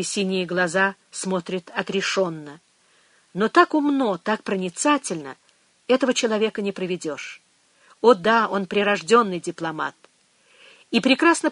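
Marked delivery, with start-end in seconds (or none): none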